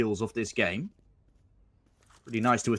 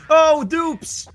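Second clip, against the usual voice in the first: screaming the clutch, high and loud; esports caster; 11 dB louder than usual. Real, not acted. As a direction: erupt on the clutch play. Clutch play called live.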